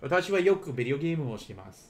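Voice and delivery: quick run-through at full speed; fast. Shown as a demonstration. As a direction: rattle the sentence off fast. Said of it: "remember that you can literally".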